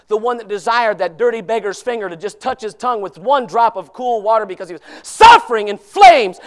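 normal talking voice

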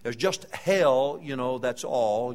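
slow, loud pronouncement from the pulpit; booming declaration. Acted. Preacher warning the congregation, slow and booming.

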